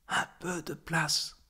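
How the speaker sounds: a small, hushed plea in the ear; murmured request. A narrator's reading. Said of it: "murmure à mon oreille".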